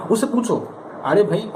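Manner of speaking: ordinary delivery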